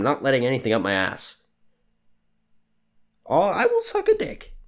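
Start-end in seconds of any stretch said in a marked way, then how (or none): 3.2-4.5 a sudden falsetto yelp, hammed up; high falsetto squeal. Joking about paying off his debt, in a high hammed-up falsetto.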